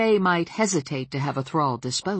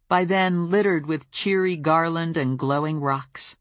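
usual speaking voice

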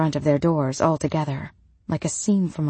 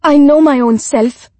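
much higher, loud indignant outburst; sharp snap; 8 semitones higher, 13 dB louder than usual. A narrator's reading. Widow rebukes Rebecca for doubting her, sharp snap. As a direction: snap back loudly, pitch jumping up. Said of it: "the widow snapped sharply at Rebecca".

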